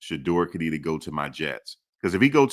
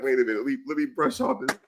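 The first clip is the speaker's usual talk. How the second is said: mock player voice, rising much higher; playful high-pitched take on the player; imitating Shedeur; 8 semitones higher, faster than usual. Host imitates Shedeur brushing off his watch, light mock voice. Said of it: "He like".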